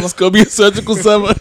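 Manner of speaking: a hammed-up, giggly echo of the line. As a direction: hype the quote up while cracking up. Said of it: "like [name] said".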